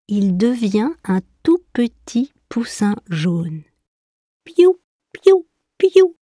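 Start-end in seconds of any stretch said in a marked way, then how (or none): 4.4-6.2 very high piou piou, small and chirpy; high little chick peeps; chick cheeping. A narrator's reading. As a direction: cheep like a tiny lost chick. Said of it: "fait doucement le poussin".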